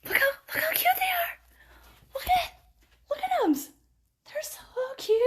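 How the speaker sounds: very high, cooing squeal; squealing delight. Real, not acted. Showing viewers her cute new pants with a high squeal. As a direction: coo over them like a puppy.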